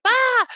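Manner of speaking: mock shriek of fright, very high and loud; imitated panicked high shriek; shrill scream. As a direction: shriek it like he saw a spider. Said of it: "he screams like".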